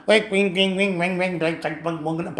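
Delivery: rapid string of comic sound effects; imitated noises. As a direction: rattle off nonsense noises for a darting look. Mimics a planet's darting glance. (விநோத சத்தங்களை mimicry செய்வது)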